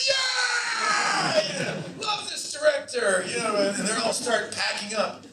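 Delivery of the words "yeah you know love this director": crew cheering. Retold or imitated. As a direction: whoop it up like a whole happy crew. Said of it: "the whole crew was like".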